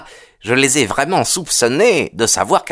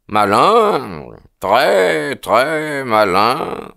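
slow, drawn-out murmur; murmured musing; much slower than usual. A narrator's reading. Holmes reacting to the detective's report, slow murmur. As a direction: draw each word out as if weighing it. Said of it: "murmura Sherlock Holmes".

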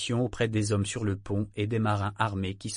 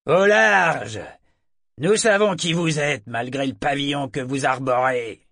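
shouted warning; 2 semitones higher, 7 dB louder than usual. A narrator's reading. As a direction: call across to the other ship. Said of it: "leur cria". (cri lancé d'un navire à l'autre)

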